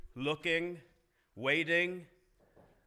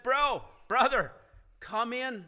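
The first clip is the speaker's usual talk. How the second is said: higher, louder, imploring; begging father; 7 semitones higher, 6 dB louder than usual. Acted. Father begging his angry son at the door, urgent. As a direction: plead with him to come inside. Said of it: "he came out and he begged him".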